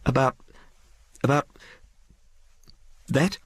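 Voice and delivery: high, halting question with long pauses; faltering. Acted. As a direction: falter nervously over each word. Frightened woman falters over question.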